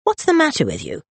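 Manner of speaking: high, pressing question; worried sister demanding an explanation; concerned. A narrator's reading. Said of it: "Concern flickered through her".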